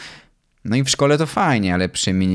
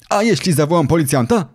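challenging threat; 7 semitones higher, 4 dB louder, faster than usual. A narrator's reading. Owner threatens father in quarrel.

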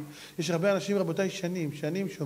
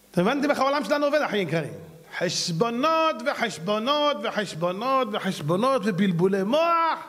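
fiery preaching; 6 semitones higher, 4 dB louder than usual. Real, not acted. Preacher's rising rebuke.

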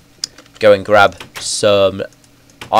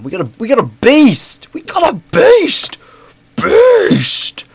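rising to a squeaky high squeal; climbing higher with each repeat; excited squeal; 4 dB louder, slower than usual. Real, not acted. Thrilled by the new find.

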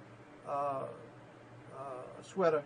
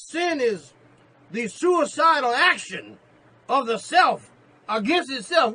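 loud, high preaching shout; preaching the quote; 9 semitones higher, 13 dB louder, slower than usual. Acted. Preacher proclaiming Keller's line to the church, loud and high. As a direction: ring the quote out to the congregation. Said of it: "Allow me to repeat that in your hearing".

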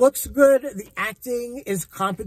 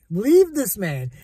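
normal talking voice

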